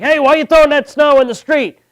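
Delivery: high, loud imitated neighbour's yell. Neighbour yelling at someone shovelling snow, imitated loud high yell. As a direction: holler it accusingly across the street. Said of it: "people yell at you".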